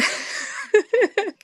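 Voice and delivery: a sudden high-pitched burst of laughter. Real, not acted. Laughing at herself after calling herself very sociable, high laugh. Joke about being very sociable.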